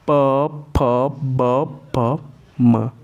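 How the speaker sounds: प, फ, ब, भ, म with both lips pressed shut; demonstrating lip sounds. Shown as a demonstration. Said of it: "दोनों ओष्ठ सट रहे हैं". (ओष्ठ्य उच्चारण)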